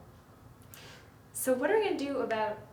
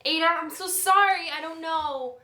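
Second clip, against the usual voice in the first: panicked apology; 6 semitones higher, 9 dB louder than usual. Acted.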